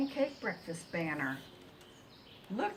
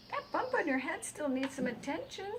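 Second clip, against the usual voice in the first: chicken voice; 10 semitones higher than usual. Acted. Ruby the chicken speaks.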